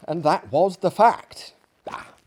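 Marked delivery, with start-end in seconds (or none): 1.9-2.1 kindly. Acted. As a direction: ease the guest's shame gently. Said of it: "he said kindly".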